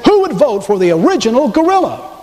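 high-pitched derisive challenge; mock jeer. Political opponents ridicule Lincoln's looks, jeering. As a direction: throw it out high as a taunt.

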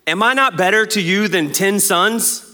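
slow, high mock plea; clueless husband. Elkanah pleads with his childless wife, mock clueless.

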